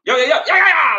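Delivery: high, loud video-hook chant; hyped-up intro shout. Retold or imitated. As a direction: pump the crowd like a wild hype man. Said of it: "I gotta be like".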